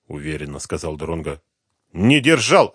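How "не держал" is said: snappish, louder retort; snapping back. A narrator's reading. Woman snaps back at the detective, sulky. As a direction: bite back at him. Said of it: "огрызнулась она".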